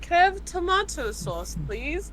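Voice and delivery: polite put-on British request, rising on please; mock British accent. Teller imitating the second guy's order, British accent. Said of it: "four British people that day".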